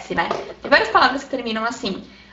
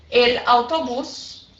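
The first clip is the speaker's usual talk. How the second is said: slow, distinct Spanish singular; slow example; much slower than usual. Shown as a demonstration. Teacher giving students the singular form, slow demonstration. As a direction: say "el autobús" slowly and separately. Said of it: "Então, eu digo".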